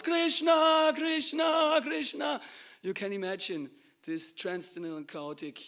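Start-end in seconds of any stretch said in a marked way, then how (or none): 0.0-2.4 high, very loud, ringing cry; ecstatic shout. Acted. Confused wife joining her husband's ecstatic shouting. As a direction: shout the name over and over in wild fervour. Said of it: "she started also to shout".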